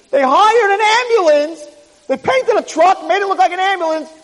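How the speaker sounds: high-pitched outraged sputtering; frantic disbelief. Acted. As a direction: protest wildly as if being kidnapped. Player protests being driven away.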